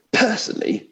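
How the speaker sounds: high, pompous ghost; lightly voiced pompous ghost. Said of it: "I'll try vaguely to put on different voices".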